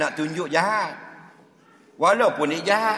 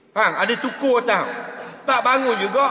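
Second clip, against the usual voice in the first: ordinary delivery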